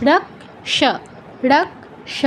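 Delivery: রক্ষ broken as rak, soft sha; k-first demo. Shown as a demonstration. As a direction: stop on k in রক্ষ, then release sha. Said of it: "কটা আগে বলতে হচ্ছে". (রক্ষ, আগে ক পরে ষ)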